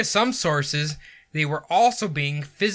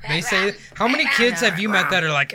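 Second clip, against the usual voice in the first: normal talking voice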